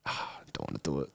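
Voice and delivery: imitating someone too lazy to get up, a soft low moan; mock lazy groan. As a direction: mumble it like you can't be bothered. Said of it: "they use their mind and just go".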